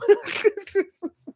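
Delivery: high laugh. Real, not acted. Laughing at the shy quote.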